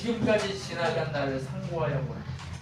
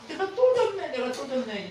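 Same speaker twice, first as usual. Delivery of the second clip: mock defeated believer; 9 semitones higher than usual. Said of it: "이렇게 하는 거예요".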